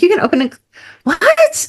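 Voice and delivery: incredulous, as if hearing nonsense; incredulous squeak. Retold or imitated. Speaker recalling first learning about chakras, incredulous. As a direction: pitch shooting up high on the final what. Quoting her own astonishment.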